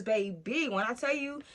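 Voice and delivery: normal talking voice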